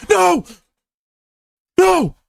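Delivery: very high, loud wail of no; anguished no. Real, not acted. Streamer screaming as he loses the game. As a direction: howl it as the win slips away.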